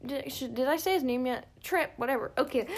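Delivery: ordinary talk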